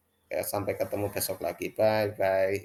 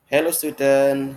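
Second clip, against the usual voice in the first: loud, slow greeting to the class; teacher greeting class; 5 semitones higher, 10 dB louder, slower than usual. Acted. Teacher greeting students, loud and slow. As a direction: call out hello like a teacher at the door. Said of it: "Mister Martin menyapa guru-gurunya".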